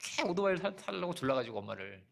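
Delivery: normal talking voice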